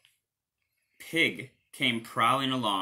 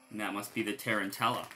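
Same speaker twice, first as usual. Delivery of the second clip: plain reading voice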